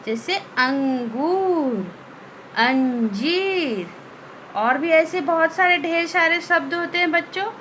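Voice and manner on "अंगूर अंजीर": अंगूर and अंजीर stretched slowly, the nasal ं clear through the nose; slow nasal demo. Shown as a demonstration. Teacher models words for the class, slow and nasal. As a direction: read each word slowly for the class. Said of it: "इसी प्रकार आपको पढ़ना है".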